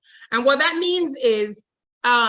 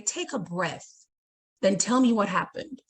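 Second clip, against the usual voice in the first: calm and soothing; 4 semitones lower, 4 dB quieter than usual. Shown as a demonstration. Teacher demos calming alternative phrase.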